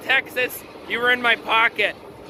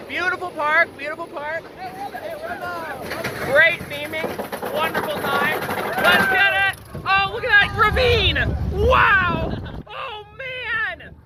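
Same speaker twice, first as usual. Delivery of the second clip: on a roller coaster; 7 semitones higher than usual. Real, not acted. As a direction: shout over the ride, thrilled. Narrating during the coaster ride.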